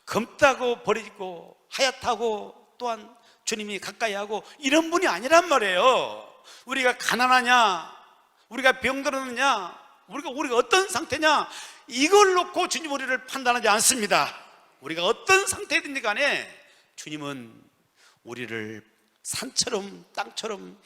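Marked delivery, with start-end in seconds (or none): 4.6-16.5 loud, rising pulpit assurance; impassioned preaching. Acted. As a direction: drive each promise home at full voice. Preaching at full force. (큰 소리로 열정적으로 외치는 설교)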